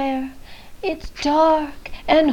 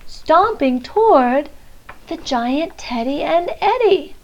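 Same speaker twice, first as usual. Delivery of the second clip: usual speaking voice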